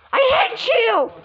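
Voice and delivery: high, slow wail; mock crying yell. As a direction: throw it out like a hurt child. Doll betrayed, crying.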